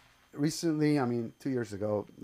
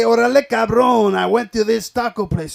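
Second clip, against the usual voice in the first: put-on a light Mexican accent, loud and high; hamming up a Mexican guy; mock Mexican guy; 9 semitones higher, 11 dB louder than usual. Imitating a Mexican man who would never say quaint. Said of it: "you never hear a Mexican say".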